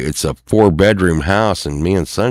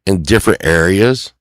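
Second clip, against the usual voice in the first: normal talking voice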